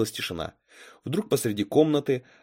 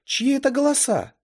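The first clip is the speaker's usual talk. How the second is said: put-on high-pitched, louder girl's question; добрая София; 5 dB louder than usual. A narrator's reading. Girl in the mirror asks what is happening, high voice. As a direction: call out in a high character voice. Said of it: "спросила добрая София".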